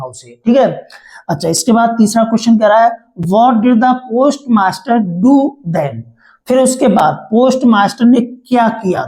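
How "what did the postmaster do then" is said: dictating slowly. Shown as a demonstration. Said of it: "teacher reads question slowly".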